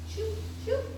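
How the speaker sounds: high, quick mock staple-gun shoops; playful sound effect of a staple gun; staple gun noise. Imitating a staple gun firing.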